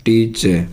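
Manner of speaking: टीचर as "tee-che", no R; silent final R. Shown as a demonstration. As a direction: close टीचर on an open "eh". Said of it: "आर का उच्चारण नहीं होगा".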